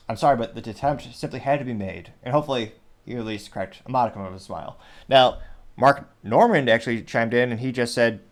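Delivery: usual speaking voice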